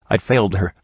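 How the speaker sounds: ordinary delivery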